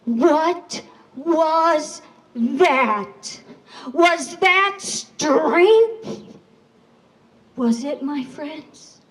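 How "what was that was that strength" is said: very slow, drawn-out, louder question; stunned disbelief. Acted. Surviving animals asking each other in disbelief. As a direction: stretch each word in shocked wonder.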